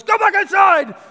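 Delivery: high, very loud alarmed yell; dad's full shout. Retold or imitated. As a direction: shout at a child in danger, full force. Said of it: "And he shouts at her".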